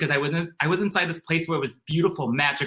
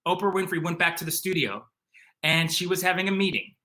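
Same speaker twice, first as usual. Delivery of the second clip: normal talking voice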